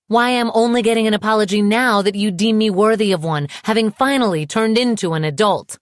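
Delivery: high, heated, indignant rant; angry tirade. A narrator's reading. Woman confronting the ex who dropped her, bitterly angry.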